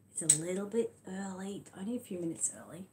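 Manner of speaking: hushed whisper as the lights go down. Real, not acted. Turning off the lights for story time, whispering. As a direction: drop to a breathy whisper, lights off.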